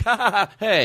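high mock laugh into sudden protest; laugh turning offended. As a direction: act out laughing, then catch the insult. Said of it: "I realize it's an insult right afterwards".

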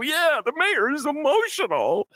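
high, slow, swooning voice of an adoring fan; a high, drawn-out mocking fan voice; mock doting Chicagoan. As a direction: gush about the mayor like a smitten local. Mocking Chicagoans excusing Daley.

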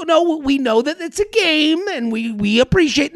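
high-pitched, hedging imitation; mock placating. Emailer trying not to offend sports fans, mock placating. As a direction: mimic someone tiptoeing round the complaint. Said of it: "they're dancing around the".

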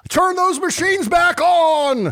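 high, slow, drawn-out theatrical demand; hammed-up wail of a ruined trader; mock outraged cry. Mock tycoon outcry over falling shares.

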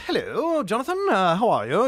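put-on high, lightly done posh British accent; fake British accent. Imitating the drama kid's fake British accent greeting Jonathan. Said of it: "faked a British accent".

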